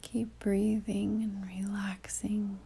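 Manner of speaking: calm meditation delivery with long pauses; guided meditation. Real, not acted. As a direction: ease the listener inward, leaving long silences between phrases.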